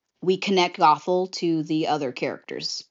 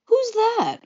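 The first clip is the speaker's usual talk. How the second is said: very high, squeaky-pitched question; mock puzzlement. Atticus hearing the royal guards, hammed-up puzzled question.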